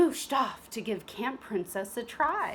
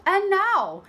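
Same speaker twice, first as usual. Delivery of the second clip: high, louder prim headmistress voice; a grand welcome to the ladies; prim teacher; 10 semitones higher, 9 dB louder than usual. Acted. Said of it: "said Madame Gertrude".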